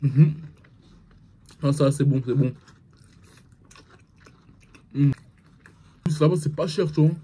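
muffled chewing between words; talking while chewing. Real, not acted. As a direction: mouth partly full of food. Seen 0: Bit wing before; lips closed, working mouth while holding wing.

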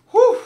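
sharp, high exhaled whew; relieved whew. Real, not acted. Player recovers after a crucial win, relieved whew. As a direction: blow out a breath in relief.

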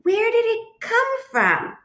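high-pitched, exasperated clarifying; huffy. A narrator's reading. Duck clarifies the question for Goose, huffy and high. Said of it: "huffed Duck".

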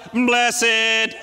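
blessed drawn out as bless-ed, two syllables; mock pious bless-ed. Preacher teasing churchy habit, mock pious bless-ed. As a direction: send up the holy-sounding bless-ed. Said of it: "it's like".

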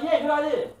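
loud, high eager reply; eager agreement. Retold or imitated. Friend agreeing at the choking scene, loud and high. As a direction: jump at the idea. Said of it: "He was like".

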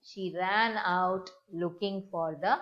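ordinary talk, nothing marked

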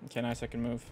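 ordinary delivery

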